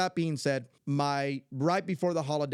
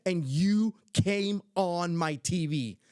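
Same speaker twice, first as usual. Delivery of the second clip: higher, slow, emphatic amazement; imitating excited granddaughter; 5 semitones higher, 4 dB louder, slower than usual. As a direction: draw out each word in childlike wonder. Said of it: "That's literally the way she said it".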